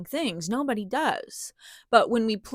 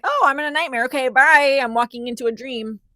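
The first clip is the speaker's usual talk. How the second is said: higher, much louder mock-cheerful brush-off; breezy dismissal; 4 semitones higher, 8 dB louder, faster than usual. Mocking someone who waves off their nightmare, breezy. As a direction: chirp it like the nightmare's nothing. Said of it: "You can't just be like".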